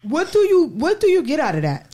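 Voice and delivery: high, loud exasperated question; exasperated demand. Real, not acted. Host challenging men who shame women, exasperated. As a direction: demand an answer, fed up.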